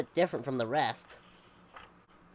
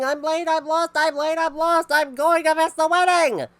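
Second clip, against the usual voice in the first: high, loud panicked cry; high frantic yell of a late man; 13 semitones higher, 11 dB louder than usual. A narrator's reading. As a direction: yell it, racing down the road. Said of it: "Then a man came down the road yelling".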